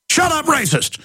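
high-pitched sneering mock shout; mocking accuser. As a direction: squeal it like a hysterical accuser. Mocking leftist accuser, shrill shout.

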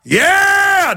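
high yell to rally the crew; hype shout. Real, not acted. Host rallies crew war growl.

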